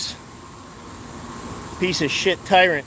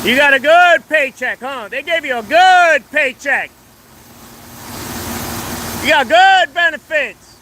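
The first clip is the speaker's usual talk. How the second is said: accusing shout; 6 semitones higher than usual. Real, not acted. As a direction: rail at him in public.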